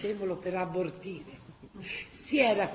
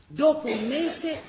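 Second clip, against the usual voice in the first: ordinary talk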